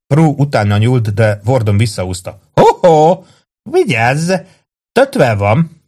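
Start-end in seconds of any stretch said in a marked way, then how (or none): 2.5-5.8 high, drawn-out tipsy caution; swooping drunken tease; playful warning. A narrator's reading. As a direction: warn him off with mock alarm. Drunk Warden snatches bottle away.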